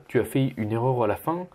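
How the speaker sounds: ordinary talk, nothing marked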